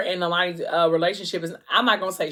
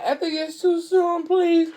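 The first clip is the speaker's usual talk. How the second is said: higher, pleading in panic as labor starts; panicked plea; 11 semitones higher, 3 dB louder than usual. Retold or imitated. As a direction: beg him like something is going wrong. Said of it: "she's freaking out".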